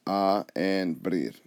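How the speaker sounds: "a" and "brir" slowly said apart; syllable by syllable. Shown as a demonstration. Teacher counting two syllables, "a" and "brir" slowly separated. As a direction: give "a" and "brir" a beat each. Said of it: "That's two syllables".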